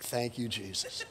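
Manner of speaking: low, slow murmured thank-you; relieved quiet thanks. Retold or imitated. Pastor miming relief at an empty movie row, soft and slow. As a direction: breathe out relief under your breath. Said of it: "It's like".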